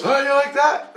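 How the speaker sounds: high-pitched, playful quoted voice; teasing Jesus voice. Retold or imitated. Jesus to the dreamer just woken, high teasing call.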